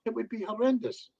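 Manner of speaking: ordinary delivery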